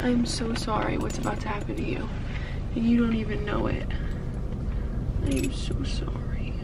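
soft, teary whisper to the dog; almost crying, barely voiced; tearful whisper. Real, not acted. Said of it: "I don't know why I'm crying".

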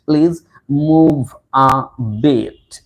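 very slow, each word of "please move a bit" drawn out; teacher's drawn-out, emphatic "move a bit"; slow model phrase. Shown as a demonstration. Teacher stretches phrase for learners.

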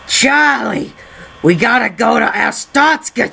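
hammy put-on trash man character. Trash man urging Charlie to Astotska, hammed-up character voice. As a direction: call out to your pal as the excitable trash man. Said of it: "make a trash man joke".

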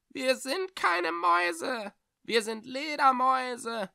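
very high, slow, thin, indignant creature voice; shrill-thin offended bats. Acted. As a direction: correct him with cold offence. Bats correct Echo, offended.

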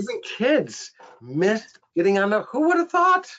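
high, amused, breaking into a laugh; laughing disbelief. Real, not acted. Telling colleagues kids missed the school bus, laughing in disbelief. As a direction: marvel at it through a laugh.

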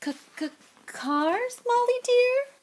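nervous stutter climbing very high; caught-out husband, meekly high; guilty stammer. A narrator's reading. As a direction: stall slowly, squeaking up on the endearment. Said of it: "He stared guiltily at his wife".

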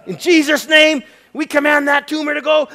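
high, loud shouted command. Retold or imitated. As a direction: cast the tumor out at full voice. Demonstrating the prayer over the girl.

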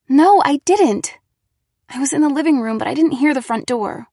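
insist, still rattled from the fright; shaken protest. Acted. Girl tells Nate she heard no bell, rattled.

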